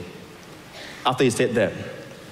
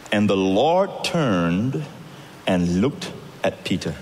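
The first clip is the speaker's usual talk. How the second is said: slow, hushed narration; 5 semitones lower, much slower than usual. A narrator's reading. Preacher telling the congregation of the Lord's look, slow and hushed. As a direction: let each word hang in the silence.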